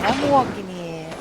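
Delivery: loud mock New York, I'm walking here; hammed-up New York street shout; New York cliche. Shown as a demonstration. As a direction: play the Brooklyn cliche. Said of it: "we don't actually go around saying".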